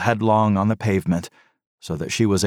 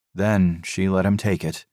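ordinary delivery, nothing marked

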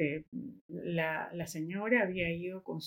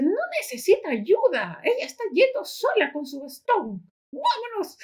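put-on high, pushy child's voice, rising to a squeak; a kid tugging his dad to leave, high and insistent; impatient little boy; 11 semitones higher, 5 dB louder than usual. Boy urging his father to walk away, high impatient child voice. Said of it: "le dice el niño".

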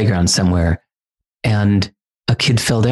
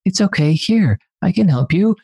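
imitating toddler comforting; 9 semitones higher than usual. Father imitates his toddler comforting a fallen child, high and sweet. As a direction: mimic a small child soothing a crying kid. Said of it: "And he's like".

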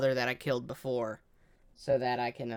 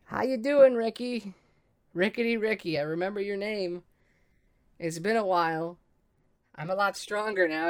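higher-pitched, familiar taunting hello; in-character greeting; 5 semitones higher than usual. Acted. Player's character to the kobold once travelled with, high-pitched familiar greeting. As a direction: greet an old foe by name in a raised, lilting pitch.

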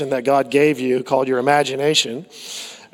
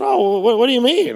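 mock surprise; 8 semitones higher than usual. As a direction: pitch jumps up in puzzled surprise. Teller's reply in story.

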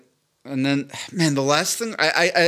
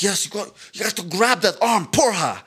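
higher, emphatic mock Brazilian coach; excited jiu-jitsu instructor; 6 semitones higher than usual. Imitating a Brazilian instructor urging a student, emphatic and raised. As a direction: drive the point home like a fired-up coach. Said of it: "Brazilian jiu-jitsu instructors ... really trying to make a point".

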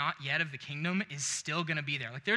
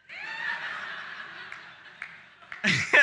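a big real laugh bursting out. Real, not acted. Big laugh at his reply.

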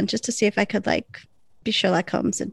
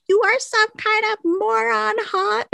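Bunny mocking; 12 semitones higher than usual. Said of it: "And Bunny's like".

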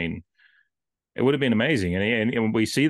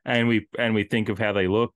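ordinary talk, nothing marked

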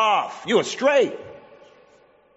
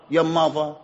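ordinary talk

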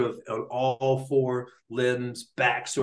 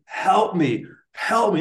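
louder, higher mock scream for help; retold scream; 5 semitones higher, 5 dB louder, slower than usual. Host mimicking his friend's cries at two A M, mock screaming. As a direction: imitate a yell without fully screaming. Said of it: "screaming at the top of his lungs".

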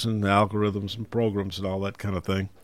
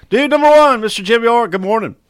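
radio announcer; 14 semitones higher, 10 dB louder than usual. Acted. Radio host introduces guests.

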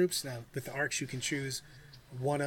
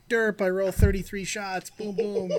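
higher, louder, dumb gloating; dopey gloating voice; 6 semitones higher, 7 dB louder than usual. Retold or imitated. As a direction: play a smug dopey player rolling dice. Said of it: "I could just stand there and go".

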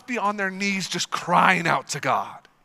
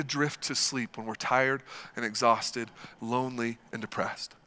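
drowsy fade; 9 semitones lower, 6 dB quieter than usual. Acted.

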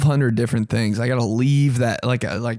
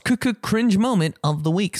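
high, stuttered jingle-like intro; segment announcer; 8 semitones higher than usual. Pretend. Host introducing cringe moment of the week, high playful announcer.

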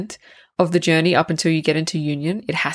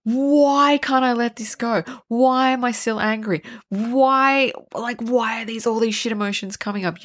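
stewing; 5 semitones higher than usual. Retold or imitated. Host acts out someone stuck stewing on it, exasperated. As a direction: imitate someone stewing, pitch raised in frustration. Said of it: "you just stew on it and you're like".

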